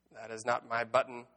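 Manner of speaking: ordinary delivery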